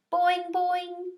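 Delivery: springy high boings; two bright cartoon boings; bouncy frog call, up high. A narrator's reading. Og the frog's sound.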